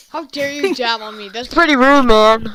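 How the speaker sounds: loud hammed-up indignant yell; mock outrage. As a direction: scold the game for being rude.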